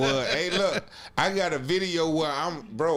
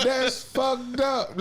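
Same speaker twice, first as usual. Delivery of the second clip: slow drawn-out; 8 semitones higher, much slower than usual. Real, not acted. Slow emphatic repeat.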